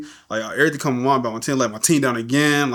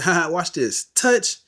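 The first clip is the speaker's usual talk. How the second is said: imitated taunt, jumping higher at the end; playful mock challenge, pitched up; 6 semitones higher, slower than usual. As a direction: mimic a cocky dare with a high final word. Retold classmate's taunt as he touches.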